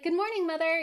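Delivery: put-on high, cheery girl's good-morning; imitating a cheerful morning hello; happy greeting. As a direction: chirp it sweetly, pitched well up. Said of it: "wishes her mom a happy, like".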